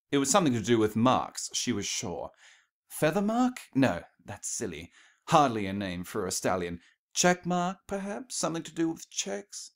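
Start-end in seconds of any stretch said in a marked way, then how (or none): 2.9-3.6 puzzled guess. Acted. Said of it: "If only she could remember". 7.1-9.7 musing guesses. Acted. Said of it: "If only she could remember".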